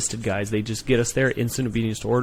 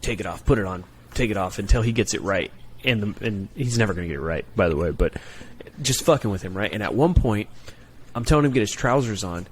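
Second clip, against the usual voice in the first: ordinary talk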